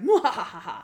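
mock villain laugh; playful imitation of a scheming cackle; evil cackle. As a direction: gloat with a hammed-up evil laugh.

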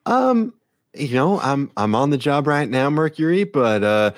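high, hesitant officer voice; officer Kara, politely evasive. Acted. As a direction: let them down gently, voice lifted. Game master plays the officer Kara.

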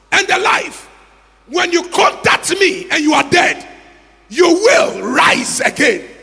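fiery preacher's proclamation; preaching at full force. Acted. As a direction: proclaim it to the congregation.

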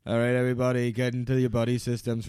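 rally the tribe like Boston Rob; Boston Rob impression. Boston Rob ordering his tribe, a loud commanding voice. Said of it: "talking like Boston Rob".

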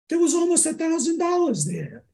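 high-pitched retold amazement; astonished mother. Mother finding money in the sock drawer, astonished. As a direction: imitate mom's voice shooting up in disbelief.

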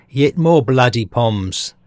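slow, mock-grumbling Aussie; put-on Australian accent, drawn out. Aussies greeting another shipload of convicts, mock Australian accent. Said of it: "or, to coin a phrase".

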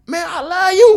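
high-pitched, loud mock plea; mock pleading. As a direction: ham up a lovesick guy begging in the rain. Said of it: "he was like".

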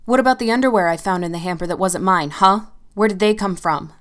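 loud, accusing demand; furious confrontation. Acted. Girlfriend loudly confronting Tim about strange underwear. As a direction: corner him with it.

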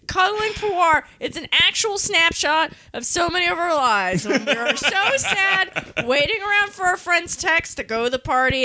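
usual speaking voice

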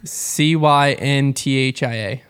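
slow letter by letter spelling; each letter spaced and deliberate. Acted.

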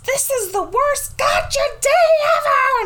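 very high-pitched wailing exclamation; mock meltdown. Imitating a child ruining his adoption day, meltdown wail. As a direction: wail it way up high like a melodramatic kid. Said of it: "utter meltdown. And he exclaims".

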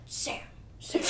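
whispered, lips pursed, scowling; lightly mock a scowling close-up; mock brooding actor. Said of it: "scowls and he purses his lips".

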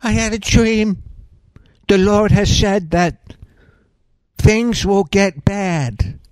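hammed-up solemn prophetic reading; mock solemn prophetess. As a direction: drag out each word like a grave oracle. Parodying prophetess revealing a dream.

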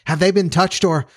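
worried cry on the run; alarmed cry. A narrator's reading. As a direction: ask in alarm, broken off at the end. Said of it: "cried the doctor".

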